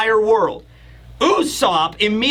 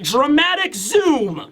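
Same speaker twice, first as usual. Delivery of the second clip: higher, slow, stretched-out announcement; mock-dramatic narration; 5 semitones higher, slower than usual. Shown as a demonstration. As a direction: draw it out like a big reveal. Narrating a dramatic panel zoom.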